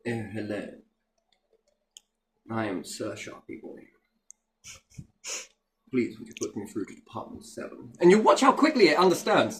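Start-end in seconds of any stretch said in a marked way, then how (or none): 0.1-7.9 posh robotic voice. Shown as a demonstration. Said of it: "talk like this to it".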